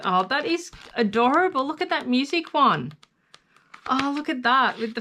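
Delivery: excited high coo; delighted gushing. Real, not acted. Gushing over cute paper pads.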